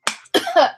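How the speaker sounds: sputtering cough on water; choking cough. Real, not acted. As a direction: cough as if water went down wrong. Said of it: "Choking on water".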